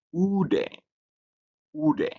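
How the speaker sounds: ude with a high oo as in group; pronunciation demonstration. Shown as a demonstration. Teacher reading the example word, ude pronounced high and separately. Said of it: "you pronounce it as".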